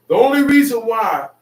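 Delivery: rising to a shout as God; God's voice building. Acted. Preacher shouting God's promise at a rebellious people.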